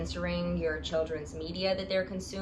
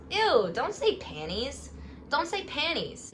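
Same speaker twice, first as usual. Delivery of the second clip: disgusted groan; 7 semitones higher than usual. Real, not acted. Reading the review, she recoils.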